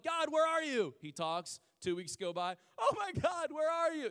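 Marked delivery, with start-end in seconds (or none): 0.0-0.9 high, loud mock cry to heaven; impatient plea. Impatient believer demanding an answer from God, high loud plea. 2.8-4.1 very high, loud mock wail; hammed-up desperate cry up to God; exasperated plea. Believer after two weeks without God talking, exasperated high wail.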